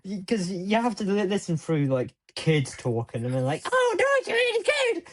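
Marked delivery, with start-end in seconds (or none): 3.7-5.0 very high-pitched mock kid voice; child impression. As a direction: squeak it like a keen little kid. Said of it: "really convincing child impression".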